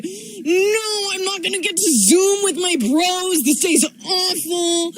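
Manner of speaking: put-on high, drawn-out childish wail; mock wail. Boy wails to the babysitter about missing his bros, high and slow. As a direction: wail it like a kid whose day is ruined. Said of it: "he sort of let out a wail".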